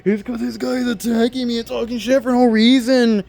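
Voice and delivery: high, put-upon victim whine; fake innocence. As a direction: play the wronged innocent. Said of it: "tried acting innocent and said".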